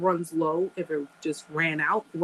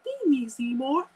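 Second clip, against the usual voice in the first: lightly voiced talking flytrap; a mild hungry plant demand; Audrey II impression; 5 semitones higher than usual. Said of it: "He's like".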